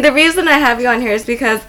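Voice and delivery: ordinary delivery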